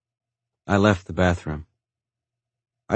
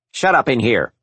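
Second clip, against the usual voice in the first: scolding; 4 semitones higher, 4 dB louder than usual. A narrator's reading. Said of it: "she scolded".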